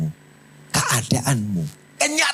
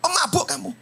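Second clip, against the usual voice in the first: high sharp rebuke, pemabuk punched; a priest snapping at a praying woman, sharply; harsh scolding; faster than usual. Acted. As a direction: scold her as a drunk. Eli's rebuke acted again.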